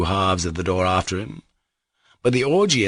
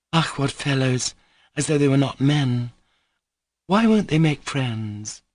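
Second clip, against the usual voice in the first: put-on tipsy young woman; 7 semitones higher than usual. Grushenka drunk.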